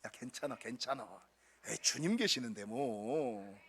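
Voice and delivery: gently calm a scared friend; reassuring pat. Retold or imitated. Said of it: "다독다독거려".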